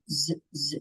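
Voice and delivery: Z sound buzzed softly. Shown as a demonstration. Teacher buzzing the Z sound for the class. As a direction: buzz the Z sound, low and soft. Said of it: "avec le son Z".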